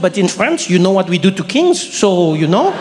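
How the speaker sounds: put-on French accent, explaining patiently; lightly imitated Frenchwoman's accent; French woman. Frenchwoman defending her countrymen to comedian, French accent.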